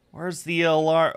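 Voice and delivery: pushing past drowsiness, a bit impatient; mildly urgent. Acted. Reading the hero hunting the clock: mildly urgent. Alarm still beeping, urgency.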